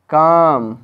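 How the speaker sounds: काम with the opening का punched and lengthened; stress on का. Shown as a demonstration. Teacher giving a second example of emphasis, का stressed in काम. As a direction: hit the का in काम hard. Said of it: "किरा पर जोड़ दिया".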